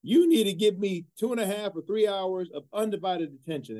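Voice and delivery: put-on a coach laying down the law in a South Carolina accent; a deliberately bad South Carolina accent, still going; Coach DeBerry South Carolina accent. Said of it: "I'm gonna do my terrible South Carolina accent".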